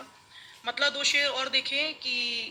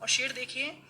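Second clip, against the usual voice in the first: normal talking voice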